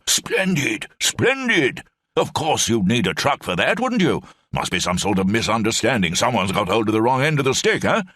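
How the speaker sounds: put-on high, hearty old officer rambling on; blustering old brigadier. Old brigadier rambles to Lamb in the cell, high blustering voice.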